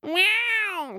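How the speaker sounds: very high mewing meow; a very high, happy cat's meow. Acted. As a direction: meow like a delighted cat. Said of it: "said the cat".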